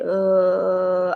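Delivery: "uh" held at the bottom of the voice; lowest range. Shown as a demonstration. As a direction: sink "uh" down low and hold it. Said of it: "the lowest range of your voice".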